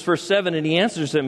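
normal talking voice

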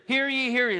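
loud, ringing town crier call; herald's cry. Acted. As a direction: proclaim it like a herald in the square. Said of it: "So the picture is".

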